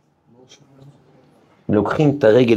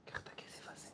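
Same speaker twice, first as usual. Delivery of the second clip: hushed, secretive whisper; 35 dB quieter than usual. Acted. Preacher whispering to the congregation to give money. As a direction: lean in and whisper the advice. Preacher urging charity quietly.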